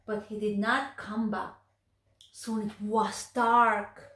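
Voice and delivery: plain reading voice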